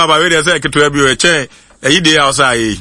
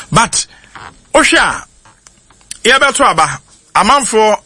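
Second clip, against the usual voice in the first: excited buildup; 6 semitones higher than usual. Real, not acted.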